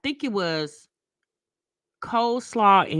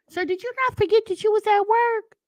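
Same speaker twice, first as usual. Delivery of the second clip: high, quick, disbelieving question; incredulous; 10 semitones higher, faster than usual. Retold or imitated. Customer looking at the young man at work, high incredulity. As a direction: pitch it up like you cannot believe him.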